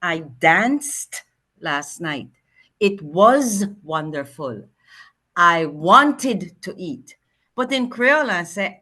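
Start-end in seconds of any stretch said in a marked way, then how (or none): 0.0-7.2 slow demo. Shown as a demonstration. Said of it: "So English, I will say".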